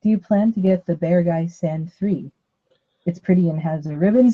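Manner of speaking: normal talking voice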